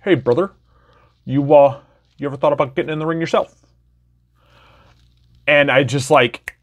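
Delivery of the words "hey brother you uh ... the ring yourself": higher-pitched impression of a local wrestling ref; Ref Kyle. Ref approaches a young wrestling fan about training, higher-pitched impression. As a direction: imitate a ref casually pitching a newcomer, voice raised in pitch. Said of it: "my best Ref Kyle impression".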